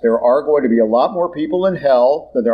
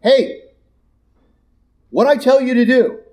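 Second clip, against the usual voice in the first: scolding God; 9 semitones higher than usual. Acted. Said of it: "get up to the judgment going".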